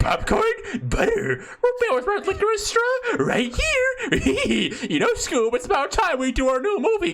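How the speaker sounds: high cartoon voice, laughing through; Scoob trailer quote. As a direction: squeak it like a cartoon dog. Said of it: "Scoob movie trailer".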